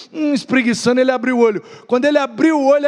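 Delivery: ordinary talk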